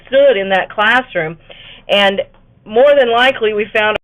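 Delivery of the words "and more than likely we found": ordinary talk, nothing marked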